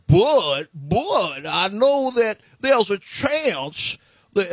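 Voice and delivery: high, loud Foghorn Leghorn Southern drawl; hammed-up Louisiana accent, booming and drawn out; Benoit Blanc. Host imitating a movie detective at work, exaggerated Southern accent. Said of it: "some kind of Southern foghorn, leghorn accent".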